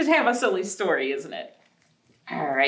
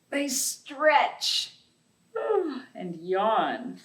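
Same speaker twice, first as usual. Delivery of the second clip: slow, gentle storybook reading; reading to children; slower than usual. Acted. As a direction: read it like bedtime storytime for little kids. Reads picture book to children.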